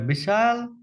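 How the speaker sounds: ordinary talk, nothing marked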